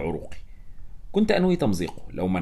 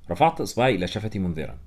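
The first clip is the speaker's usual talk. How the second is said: plain reading voice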